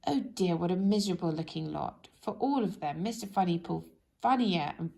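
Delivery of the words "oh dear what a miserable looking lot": plain reading voice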